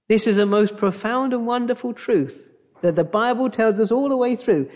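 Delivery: usual speaking voice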